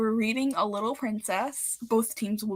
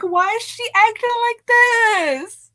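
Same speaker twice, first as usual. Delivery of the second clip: mock outrage; 12 semitones higher, 9 dB louder than usual. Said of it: "I was so mad".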